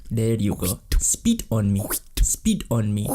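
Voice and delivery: lightly voiced evil queen; a hint of a haughty queen. Playing queen.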